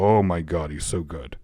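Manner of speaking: low gushing praise that sounds sarcastic. Real, not acted. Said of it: "I said that sarcastically".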